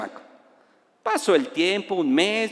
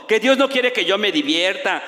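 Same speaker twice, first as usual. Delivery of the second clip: ordinary talk, nothing marked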